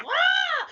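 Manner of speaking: a sudden high yelp of a howl; howling laugh. Retold or imitated. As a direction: mimic an audience member howling. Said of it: "like, howling".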